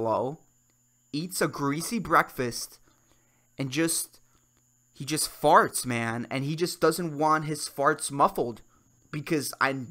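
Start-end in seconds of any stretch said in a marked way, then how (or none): none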